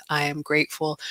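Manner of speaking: normal talking voice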